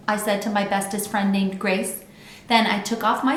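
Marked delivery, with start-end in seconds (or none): none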